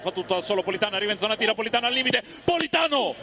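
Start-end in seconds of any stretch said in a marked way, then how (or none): 1.6-3.1 excited call. Real, not acted. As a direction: pitch climbing to a high shout. Building shot call.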